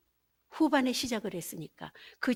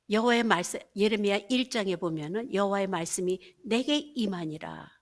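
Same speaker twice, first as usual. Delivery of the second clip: ordinary delivery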